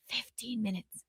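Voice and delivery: very quiet, falling repeat; hushed disbelief. Real, not acted. Host repeating the fifteen minutes, hushed. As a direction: drop it to an under-breath echo. Same words repeated under the breath.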